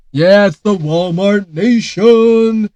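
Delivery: loud, slow, grand announcement; slow loud mock-grand slogan; mock proclamation. Real, not acted. Announcing Walmart map.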